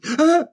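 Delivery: high, loud mock gasp; sudden comic yelp of fright; startled gasp. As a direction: gasp as if touching a snake. Acts sudden fright at finding snake.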